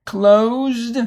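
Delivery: teacher stresses the voiced ending; D sound ending. Shown as a demonstration. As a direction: land firmly on the d of Closed. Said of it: "it will have the D sound".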